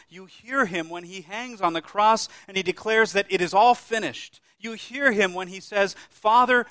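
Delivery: loud, ringing proclamation slowing at the end; full-force preaching. Acted. Preacher to congregation retelling the crucifixion, booming preaching. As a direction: thunder it out from the pulpit.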